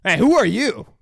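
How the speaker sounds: loud high "who are you"; mock shout. Retelling the guard catching Mike, loud mock shout. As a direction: call out to the stranger. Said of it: "he's like".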